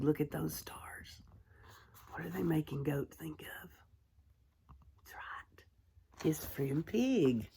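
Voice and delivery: whispered aside over the picture; hushed whisper. Real, not acted. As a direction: lean in and whisper. Pointing at picture for children.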